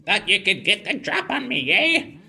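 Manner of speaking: put-on high, loud, strained old granny; grandma voice. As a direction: croak like a feisty old lady. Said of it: "that hurt my throat".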